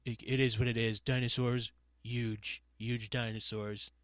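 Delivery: low, soft impression of a politician; understated parody, dropping the pitch and volume; Trump.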